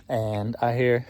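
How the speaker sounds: ordinary talk, nothing marked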